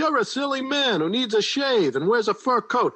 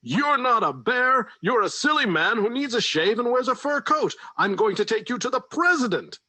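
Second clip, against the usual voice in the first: raging vice president; 10 semitones higher than usual. Acted. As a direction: rant at the bear. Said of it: "yelled in rage".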